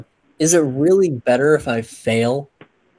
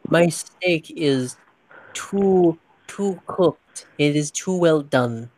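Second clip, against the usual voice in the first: slow, higher-pitched mock posh diner; attempted posh accent; 4 semitones higher than usual. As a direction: put on a weird, stilted posh accent. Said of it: "Stop with the accent. That shit's weird".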